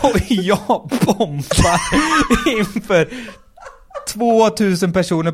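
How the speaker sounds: high, slow laughing while telling; giggling at his own disaster. Real, not acted. Telling friend he bombed, laughing.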